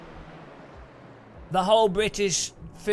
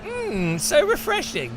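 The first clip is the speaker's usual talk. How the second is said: high, hammed-up savouring; mock refreshed; 9 semitones higher, slower than usual. Salt lover with seawater up the nose, mock refreshed. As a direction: pretend to relish something horrible. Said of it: "you're like".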